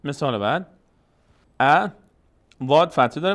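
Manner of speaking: usual speaking voice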